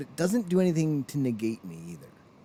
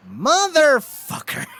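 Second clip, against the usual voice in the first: much higher, louder, slow cursing outburst; frustrated outburst; 14 semitones higher, 8 dB louder, much slower than usual. Retold or imitated. Eleven-year-old [name] cheated by the scene, slow loud curse. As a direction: drag out the curse in outrage. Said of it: "I remember sitting there going".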